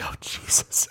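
very soft, whispered exclamation; overwhelmed whisper. Retold or imitated. Man retelling crying at the movie, whispering. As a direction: whisper it as if overcome.

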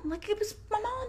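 real tears about her mom; voice breaking. Real, not acted. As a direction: let it crack as the tears come. Repeats line, voice breaking.

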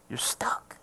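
sudden low whisper; hushed emphasis. Real, not acted. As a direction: drop to a whisper for the punchline. Dramatic drop ending the point.

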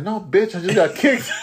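high, laughing exasperated comeback; laughing retort. Retold or imitated. Guard to the clinic girl, laughing exasperated retort. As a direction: toss it back through a laugh.

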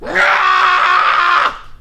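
long high screaming yell. Retold or imitated. Voice actor recalling sessions, high piercing scream. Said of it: "I'd scream for two hours".

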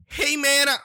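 a high, loud put-on Aziz voice; a quick stab at a celebrity voice; Aziz Ansari impression. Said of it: "oh, that's not his voice".

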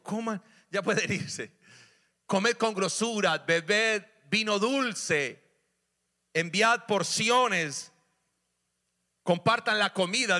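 slow, loud, high reading; preacher declaring a feast verse to the church; proclaiming scripture. Acted. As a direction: draw out each command. Reading Nehemiah with force.

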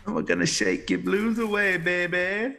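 loud, high-pitched crowd-pumping shout; hype man. Acted. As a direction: pump up the crowd like a gig MC. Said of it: "I should be a hype man".

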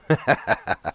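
low, soft chuckle. Real, not acted. Host amused by his own complaint about his wife, low chuckle. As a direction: laugh quietly at your own joke.

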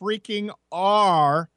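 "R" drawn out with a full R sound; emphatic hard R. Shown as a demonstration. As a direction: stretch the R in "R" very slowly. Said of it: "They don't pronounce their R's".

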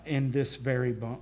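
ordinary delivery

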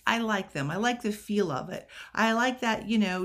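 ordinary delivery

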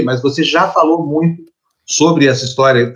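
usual speaking voice

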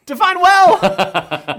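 very high, loud, stretched-out joking squeal; mock-anguished outburst. Dad-to-be dodging how he is doing, exaggerated mock-anguished cry. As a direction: blow the gag up, high and long. Repeats joke louder, laughter follows.

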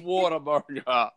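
"Whataburger" drawn out in a Long Island "wawta"; Long Island accent. Shown as a demonstration. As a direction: say "Whataburger" like a New Yorker. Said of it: "Forget about it".

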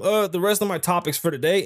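ordinary delivery

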